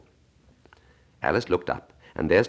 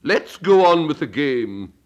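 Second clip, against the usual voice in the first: much higher, louder, slow raging Queen; Queen shouting; 9 semitones higher, 5 dB louder, slower than usual. Acted. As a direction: hurl the ultimatum down at her. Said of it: "the Queen said to Alice".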